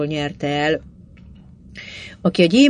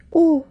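a high, sighing oh; a mild narrated sigh of wonder; longing sigh; 11 semitones higher than usual. A narrator's reading. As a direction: breathe out a wistful, higher oh. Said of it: "sóhajtotta nem is egyszer".

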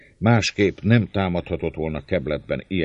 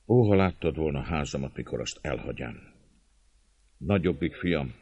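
ordinary delivery

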